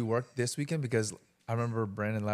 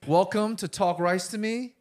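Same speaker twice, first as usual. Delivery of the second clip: slow, higher-pitched welcome; show host intro; 7 semitones higher, 3 dB louder than usual. Real, not acted. Host welcoming listeners to the podcast, show host intro.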